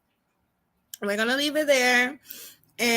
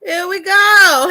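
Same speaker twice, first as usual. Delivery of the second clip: excited; 6 semitones higher, 11 dB louder, slower than usual. Real, not acted. Reactor hypes up the clip.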